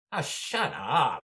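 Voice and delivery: loud, high, drawn-out yell; slow mock bellow of annoyance; fed-up heckler shout. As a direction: imitate an exasperated man shouting someone down. Imitating fed-up Australian heckler.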